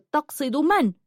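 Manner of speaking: high, taken-aback question; stunned. A narrator's reading. Julanar turns to her challenger, stunned. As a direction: ask in astonishment, pitch jumping up. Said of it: "التفتت في ذهول وسألته".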